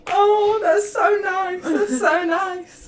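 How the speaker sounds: high, loud, slow teary coo; imitating a tearful aunt praising the TV; weepy gushing aunt. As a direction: drag it out like a sobbing doting aunt. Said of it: "she'd go".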